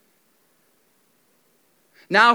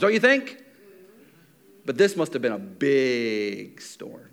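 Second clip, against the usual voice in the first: ordinary talk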